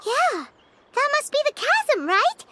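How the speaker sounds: high, eager guess; piping up with the answer; eager realization. Acted. Paimon guessing the location, high and eager.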